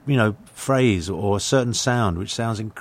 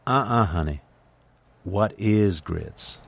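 slow, whispered Southern drawl, mock-correcting; Southern waitress; slower than usual. Waitress corrects an English customer, in a slow whispered Southern drawl. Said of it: "And she said".

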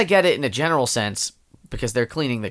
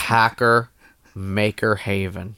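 flat boring read; 6 semitones lower, much slower than usual. Acted. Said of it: "in the cool guy hacker voice".

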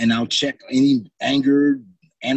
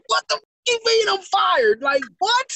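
high outraged shout of disbelief; retold furious shout at being fired; pissed disbelief. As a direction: yell it like you can't believe you got fired. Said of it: "I was so pissed".

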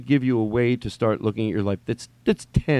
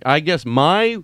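ordinary delivery

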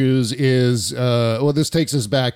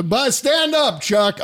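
much higher, hammed-up summons; mock rousing call; 11 semitones higher, slower than usual. Host mimicking a politician asking Chuck to stand, hammed-up call. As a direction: call out grandly, pitched well up. Mimicking Biden's gaffe from clip.

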